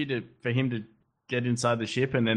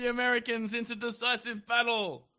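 stern commander; 9 semitones higher than usual. Retold or imitated. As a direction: play a stubborn old general. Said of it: "And he's like".

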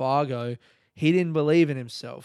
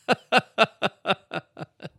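high, loud burst of laughter; hearty laugh; 8 semitones higher, 6 dB louder than usual. Real, not acted. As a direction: crack up at the punchline. Laughs at his own retold punchline.

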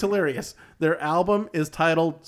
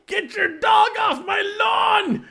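put-on old prospector; 4 dB louder than usual. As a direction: yell like a cranky old man. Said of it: "damn whippersnapper".

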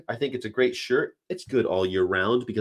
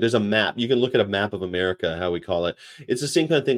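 normal talking voice

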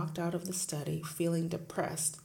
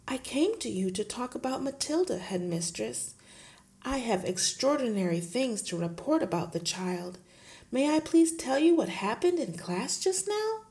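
lightly voiced gentle teacher; a hint of the meek young teacher; Miss Honey pleading; 7 semitones higher, 6 dB louder than usual. Teacher protesting to her headmistress, lightly voiced.

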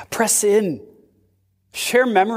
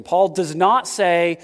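ordinary delivery

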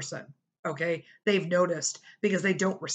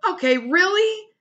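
much higher and louder disbelief; exasperated sister; 12 semitones higher, 7 dB louder than usual. Retold or imitated. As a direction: scold dad like he's a child. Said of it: "she called my dad and she's like".

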